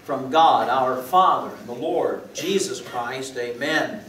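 slow, solemn greeting of grace; liturgical blessing. Acted. Pastor blessing the congregation, slow and solemn. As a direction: bless the congregation slowly and gravely.

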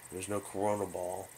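usual speaking voice

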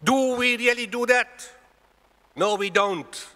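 loud, high, slowed-down preaching cry; forceful rhetorical challenge. Real, not acted. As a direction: hammer the question at the congregation. Repeats the question at full force.

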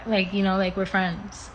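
ordinary delivery

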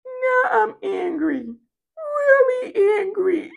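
put-on very high, slow, squeaky pout of a small child; hammed-up toddler fury, high and whiny; imitating a four-year-old saying she's angry, in a tiny high voice; little girl's angry voice. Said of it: "Like my four-year-old".

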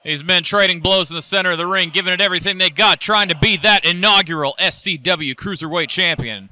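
normal talking voice

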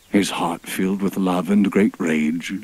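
plain reading voice

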